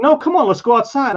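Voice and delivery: goading him to step outside; mock aggressive taunt; taunting challenge. Retold man picking a fight.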